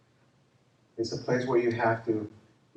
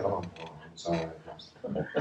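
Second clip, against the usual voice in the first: meek apology; 9 semitones lower, 8 dB quieter than usual. Retold or imitated. Gang member apologizes meekly.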